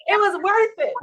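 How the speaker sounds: high, loud gloating declaration; high loud triumph; triumphant. Retold or imitated. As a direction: announce the victory. Celebrating holding out.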